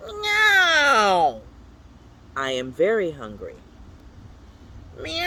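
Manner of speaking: high, loud, drawn-out yowl; a loud falling cat yowl; insistent meow. Acted. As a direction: demand food like a starving cat. Cat sound list, very hungry.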